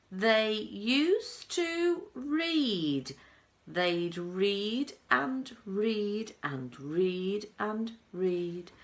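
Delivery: each word drawn out slowly and loudly. Shown as a demonstration. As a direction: say it very loud and slow. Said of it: "say it very loud and slow".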